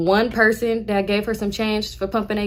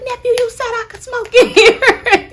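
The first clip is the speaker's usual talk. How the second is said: high whiny protest; 8 dB louder, slower than usual. Retold or imitated. Aunt protests, laughing.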